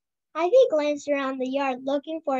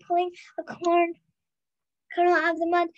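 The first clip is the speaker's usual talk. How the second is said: ordinary delivery, nothing marked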